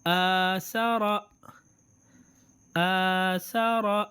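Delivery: آ سارا drawn out slowly, long vowels held; slow reading demo. Shown as a demonstration. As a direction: stretch آ سارا slowly and clearly. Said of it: "تو یہ standing فتحے کا ہے".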